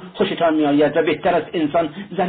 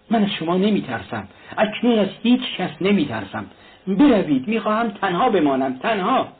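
rising, exasperated outburst, higher as it goes; angry; 6 semitones higher than usual. A narrator's reading. Raskolnikov driving his visitors away, angry and higher. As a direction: drive them out, stressing تنها. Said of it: "راسکولنیکوف با عصبانیت گفت".

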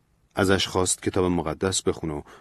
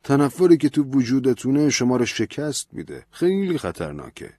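serious, urging plea; earnest pleading; 6 semitones higher than usual. A narrator's reading. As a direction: beg him seriously. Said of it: "با جدیت بهش التماس کرد". (التماس جدی)

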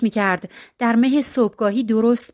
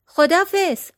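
high-pitched shouted goodbye; called-out shout; 8 semitones higher than usual. A narrator's reading. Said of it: "داد زد". (با فریاد و صدای زیر)

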